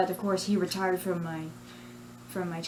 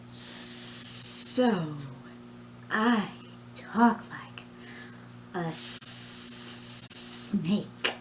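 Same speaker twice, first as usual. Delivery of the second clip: hissing snake voice; drawn-out s like a snake; much slower than usual. Shown as a demonstration. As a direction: whisper it like a snake. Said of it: "doing voices of a snake person".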